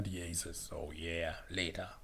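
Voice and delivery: "[name]" tief und leise wie ein cooler Rocker; lässig raunen wie ein Rockstar; coole Rockstimme. Shown as a demonstration. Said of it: "[name] klingt ja richtig in eine Richtung". (tiefe, leise Rockerstimme)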